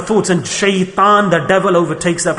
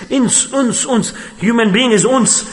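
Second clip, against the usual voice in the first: ordinary delivery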